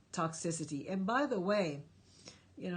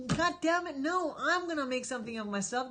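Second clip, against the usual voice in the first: higher and louder, fed-up cry; defiant outburst; 6 semitones higher, 3 dB louder than usual. Pretend. Viewer vowing to rise above being fooled. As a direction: burst out like you've had enough. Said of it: "and say".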